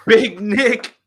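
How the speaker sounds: high, amused ribbing of a guest; laughing tease at an eager guest; teasing laugh. Real, not acted. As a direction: tease him through a laugh.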